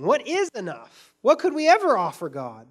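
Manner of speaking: preacher's rising cry; 1 calling out. Real, not acted. Preacher crying out to the church, loud. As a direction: ring out the question to the congregation.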